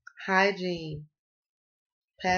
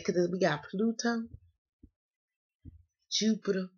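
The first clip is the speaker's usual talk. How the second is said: normal talking voice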